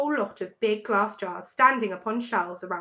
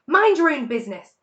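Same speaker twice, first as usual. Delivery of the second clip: loud shrill squeal; shrieked; 5 semitones higher, 8 dB louder than usual. A narrator's reading. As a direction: screech it back. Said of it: "shrieked Rat".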